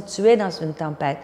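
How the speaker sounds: ordinary talk, nothing marked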